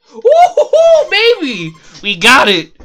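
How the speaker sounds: high whooping victory cheer, loud; stretched high woo-hoo of triumph; excited cheer. Real, not acted. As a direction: whoop it up like you just won. Celebrating good RNG.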